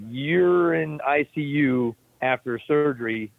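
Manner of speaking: drawn-out, imitated disbelief; slow incredulous explaining. Staffer refusing patient just out of surgery, slow incredulous explaining. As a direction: stretch it out as if explaining the obvious.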